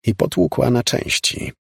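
ordinary delivery, nothing marked

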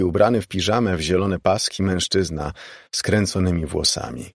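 ordinary delivery, nothing marked

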